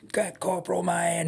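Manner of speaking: high, strained distant rant; held-back mock yell. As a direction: imitate a rant down the hall. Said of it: "full on ranting coming down the halls".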